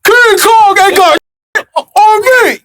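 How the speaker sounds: slow booming brag; chest-thumping King Kong boast; boastful shout. Acted. Boasting playfully.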